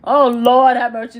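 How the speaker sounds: loud exasperated cry to heaven. Real, not acted. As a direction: throw it up loud, Lord have mercy. Exasperated at the clip.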